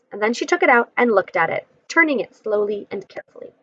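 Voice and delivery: ordinary delivery, nothing marked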